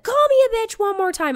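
high-pitched mock threat; angry dare. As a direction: imitate a furious friend daring you. Friend storms in, daring her.

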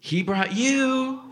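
high, drawn-out incredulous echo; mock astonishment. Comedian echoing an audience member who was brought along, mock astonishment. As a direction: echo it back slowly, way up high.

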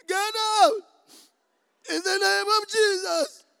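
loud, high, wailing command; hammed-up tearful plea at full volume; mock crying. Believer obeys the mountain's taunt, wailing in mock tears. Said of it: "Now say it crying".

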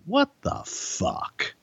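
slow, high, drawn-out disbelief; stunned disbelief. Retold or imitated. The writer reacting to being dumped with the work, stunned. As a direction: let it hang in stunned shock. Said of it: "I just was like".

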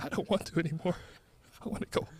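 quiet, shrinking voice; a mock-frightened plea; mock scared. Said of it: "You're actually crying".

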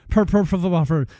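rushed, stumbling over "Per Pro Football Focus"; fast tongue-twister attempt. Shown as a demonstration. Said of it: "Wow, that's a tongue twister".

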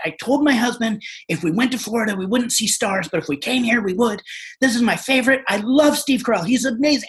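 high-pitched, excited mock gushing; imitating starstruck freak-out. Lady spotting her favorite actor on set, mock freak-out. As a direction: gush like an overwhelmed fan, pitch up. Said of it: "freaking out and getting loud".

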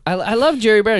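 ordinary talk

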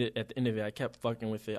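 usual speaking voice

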